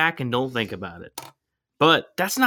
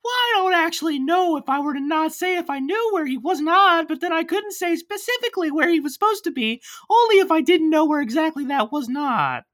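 very high-pitched puppet voice; cartoon wooden-boy falsetto; Pinocchio. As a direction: babble evasively in a high, even squeak. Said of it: "and Pinocchio's like".

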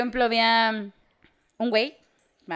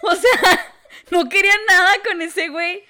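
high, loud words through laughter; laughing; 10 semitones higher, 5 dB louder than usual. Real, not acted. Telling a friend she wanted nothing with him, laughing. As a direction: laugh it out, much higher.

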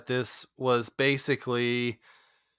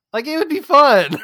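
high, loud and thrilled; excited; 14 semitones higher, 10 dB louder than usual. Real, not acted. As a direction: gush with delight. Excited gush over sequel idea.

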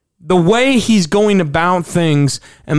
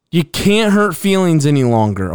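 ordinary delivery

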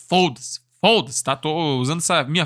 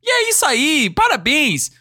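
cheering bottle captions; 7 semitones higher than usual. Acted. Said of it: "tem várias linhas falando assim".

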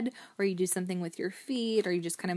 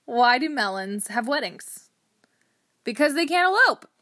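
louder, higher setup, punchline pushed up high; dad-joke delivery; 5 semitones higher, 8 dB louder than usual. Acted. Host shares dad jokes to bring some laughter, hammy joke-telling. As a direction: play up the silly riddle and its punchline.

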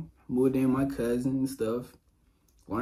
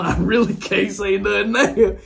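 higher and louder, laughing through it; laughing defeat; 9 semitones higher, 10 dB louder than usual. Retold or imitated. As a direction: laugh at being beaten. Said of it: "So I'm like".

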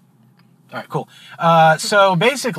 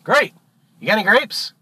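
high-pitched duck punchline; cheeky duck, pitched up; triumphant duck; 8 semitones higher than usual. Acted. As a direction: pop the question in a high, rising voice. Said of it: "The duck goes".

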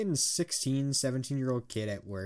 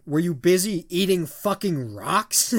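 louder mock yell, slowed and punched; retold road-rage yell; 5 semitones higher, 6 dB louder, slower than usual. Retelling yelling at a driver who cut him off, mock furious. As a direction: replay yelling at a careless driver. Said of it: "it just made me yell".

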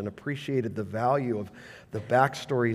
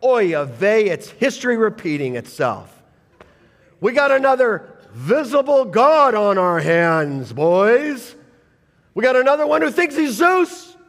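exasperated crowd; 7 semitones higher, 7 dB louder than usual. Retold or imitated. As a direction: ham up their alarm at another god-king. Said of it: "And they're like".

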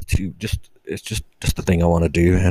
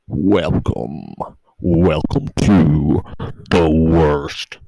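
slow, booming hammed-up host welcome; louder and slower as it builds; mock show host; 5 dB louder, much slower than usual. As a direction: drag out the welcome like a cheesy announcer.

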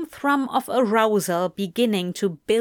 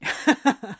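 short amused laugh; a quick fond chuckle; soft laugh; 3 semitones higher than usual. Acted. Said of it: "and Crowley laughed".

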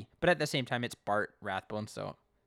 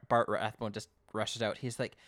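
usual speaking voice

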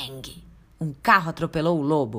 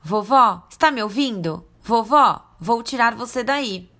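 louder, calling out to grandma; to someone hard of hearing; 5 semitones higher, 5 dB louder than usual. Acted. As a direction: shout so she hears. Calling grandma inside the wolf.

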